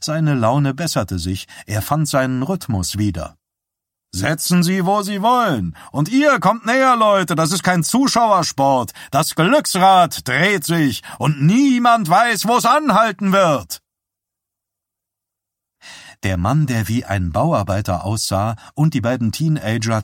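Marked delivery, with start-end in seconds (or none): 4.1-13.8 high, loud carnival barker patter; showman's call. A narrator's reading. Showman luring onlookers to the wheel, barker's loud call.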